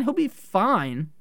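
ordinary talk, nothing marked

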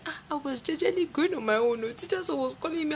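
high whiny fake sob; mock crying to father. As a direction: whine it like a wronged child. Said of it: "you now start crying".

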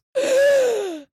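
sharp, high gasp for air; sudden gasp. Retold or imitated. Unconscious man suddenly gasping awake, sharp high gasp. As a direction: gasp back to life. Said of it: "he just goes".

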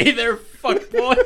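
high squeaky laughing voice. Retold or imitated. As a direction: crack up while saying it. Repeats alien line laughing.

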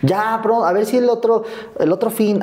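mom's vague promise. Retold or imitated. Mother promising son a visit: high evasive reply. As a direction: brush him off sweetly. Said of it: "mom's reply on phone".

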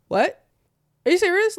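high, loud incredulous question; stunned disbelief. Retold or imitated. Daughter hears by phone dad is home, stunned disbelief.

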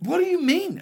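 high, squeaky disbelief; shocked what do you mean; incredulous. Real, not acted. Mock-indignant at rules accusation.

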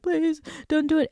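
high mock-scared plea; acting scared. Bagged prank actor pleading with captor, acting scared. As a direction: imitate someone begging for mercy. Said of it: "also acting scared".

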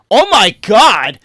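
loud, slow, high exclamation; hammed-up disgusted shock; mock horrified outcry. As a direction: cry out as if hit by a stench. Bystanders recoil from bathroom smell.

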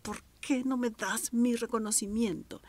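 a touch of an aggrieved demand; mild angry demand. Retold or imitated. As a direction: voice the hot-tempered person's complaint. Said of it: "lo hago de manera muy enojada, muy agresiva".

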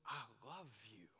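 mean it deeply and softly; tender whisper. Shown as a demonstration. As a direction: intimate heartfelt whisper. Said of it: "It was".

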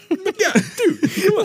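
very high, laughing squeak; high Boo Boo voice. Retold or imitated. Friend imitates Boo Boo, high and laughing. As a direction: squeal like the sidekick, cracking up. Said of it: "Boo Boo is like".

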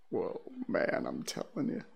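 breathy creeped-out whisper; hushed whisper. Real, not acted. Host creeped out by a reader story, whispering.

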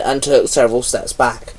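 usual speaking voice

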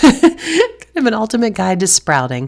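laughing through the words; laughing at herself. Real, not acted. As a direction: giggle while correcting yourself. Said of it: "I don't know why I just said sprouting".

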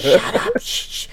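very high mock shush, laughing; shushing wife. Wife hushes him in church, very high mock shushing. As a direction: hiss a shush like a scolding wife. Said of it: "My wife was like".

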